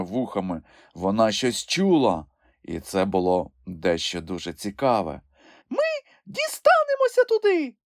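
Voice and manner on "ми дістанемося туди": very high, squeaky little creature voice; a tiny animal piping up in excitement; Kulka exclaiming. A narrator's reading. Kulka tells Grampus they are almost there, squeaky excited cry. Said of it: "радісно вигукнула вона".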